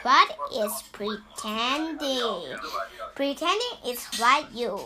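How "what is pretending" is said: plain reading voice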